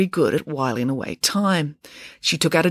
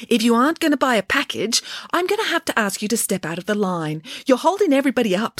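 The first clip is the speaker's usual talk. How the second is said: bossy prefect, lightly voiced; a hint of a strict prefect; school prefect; 7 semitones higher than usual. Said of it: "more like a school prefect".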